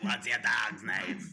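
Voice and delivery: loud, slow, bellowed Terminator voice; over-the-top Arnold Schwarzenegger bellow; mock Arnold shout. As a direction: bark it out like a hammy Arnold. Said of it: "What do you sound like, [name]?".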